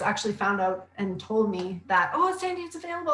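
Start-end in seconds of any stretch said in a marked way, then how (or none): 2.1-3.1 high, bright-pitched excited announcement; excited news. Retold or imitated. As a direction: tell her the news with a lift. Clients telling her book out.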